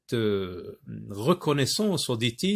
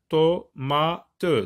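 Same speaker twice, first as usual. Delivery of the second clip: to-ma-te in three measured beats; short pauses between to, ma and te; syllable by syllable. Shown as a demonstration. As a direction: sound out to-ma-te piece by piece. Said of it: "je découpe ici et j'obtiens".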